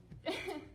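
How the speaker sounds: a short soft embarrassed laugh. Real, not acted. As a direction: laugh it off, quietly. Laughs at her own emotion.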